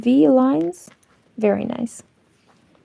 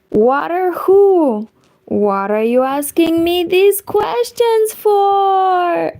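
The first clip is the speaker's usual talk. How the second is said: punchline voice; 7 semitones higher than usual. Acted. Acting out knock-knock punchline.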